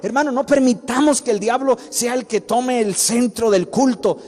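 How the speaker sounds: high, loud pulpit exhortation; rally the church with full force; fiery preaching. Acted. Pastor urging the congregation to cast out demons, preaching at full force.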